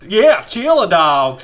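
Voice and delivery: very high, very loud cartoon cheer; Sonic impression. Joining the impressions, high loud catchphrase. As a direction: shout it high like a cartoon hero. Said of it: "doing an impression of Sonic".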